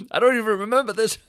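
high, loud burst of disbelief; indignant protest. Retold or imitated. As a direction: cry out in mock outrage. Protests at being told off.

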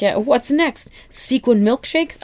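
high-pitched sarcastic jeer; mocking. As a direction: ridicule the idea with hammed-up questions. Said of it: "they mock the shit out of him".

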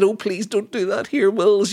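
high, fluttery put-on gossiping voice; hammed-up scandalised society gossip; mock gossip voice. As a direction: send up the royals' petty fuss. Continues royal mock voice.

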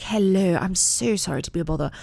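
dainty British daughter impression; little British voice. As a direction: put on a young girl's very proper British accent. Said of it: "Violet's little British voice".

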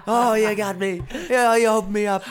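high, loud, giggling confession; laughing mock surrender. Giving in to a friend's probing, high laughing mock confession. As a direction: play-act being caught out, laughing. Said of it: "I'm just like".

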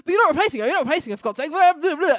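hammed-up touchy Doctor; mock defensive outburst. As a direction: blurt it defensively, overreacting. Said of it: "And he's like".

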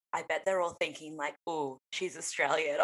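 low, hushed inner voice; retold private thought. Imagining strangers overhearing a phone call, hushed retelling. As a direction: murmur it as an onlooker's secret remark.

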